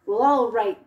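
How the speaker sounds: loud, reluctant grumble; grumbling troll. Acted. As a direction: give in grudgingly like a troll. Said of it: "grumbled the troll".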